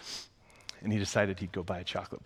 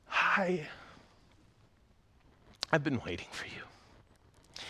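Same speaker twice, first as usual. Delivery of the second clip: soft, slow, tender welcome; tender greeting; 5 semitones higher, 3 dB quieter, slower than usual. Acted. Jesus greets the women at the tomb, tenderly. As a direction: welcome them gently.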